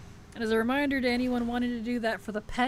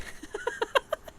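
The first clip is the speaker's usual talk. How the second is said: high breathy laugh. Real, not acted. Laughing at own story.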